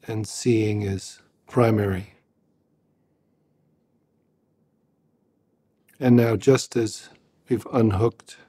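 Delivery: a calm meditation teacher's guiding voice; guided meditation. Acted. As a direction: lead them gently into just hearing.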